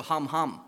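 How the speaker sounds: dog barking hum hum; Romanian dog sound. Shown as a demonstration. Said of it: "In Romania, they go".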